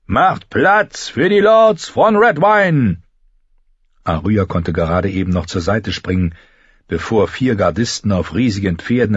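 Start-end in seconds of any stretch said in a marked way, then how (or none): 0.1-3.0 slow, drawn-out higher call; herald. Acted. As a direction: announce the lords loudly. Herald announces lords. (langsamer Heroldsruf)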